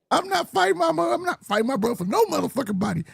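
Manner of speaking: high-pitched retold outburst, not shouted; mock flipping out. Boxer refusing to fight his brother, mock flipping out. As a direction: imitate an indignant, worked-up refusal. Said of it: "Shakur flipped the fuck out".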